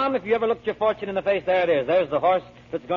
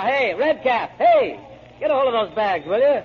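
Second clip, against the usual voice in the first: hailing a porter; 6 semitones higher, 5 dB louder than usual. Acted. Joe hails the porter.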